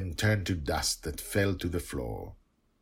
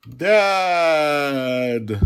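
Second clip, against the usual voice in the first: long, high, loud scream to a parent; child's wail; 9 semitones higher, 11 dB louder than usual. Acted. Child cries out to her father as his spells fail, wailing. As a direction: drag the word out in a high yell.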